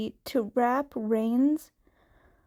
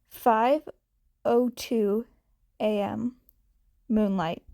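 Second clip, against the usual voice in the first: plain reading voice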